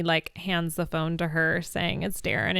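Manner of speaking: usual speaking voice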